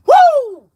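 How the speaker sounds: a high, loud woo in excitement; excited whoop. Real, not acted. Reacting to overtime at fifty-five, high loud whoop. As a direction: whoop like the number thrills you.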